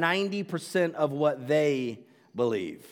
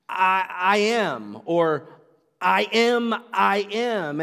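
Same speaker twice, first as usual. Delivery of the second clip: I am, slowed and louder, heavy weight on am; emphatic declaration; 3 semitones higher, 4 dB louder, slower than usual. Retold or imitated. Pastor echoes how the crowd heard I am, loud and emphatic. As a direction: proclaim I am like a ringing echo. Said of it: "what it sounded like".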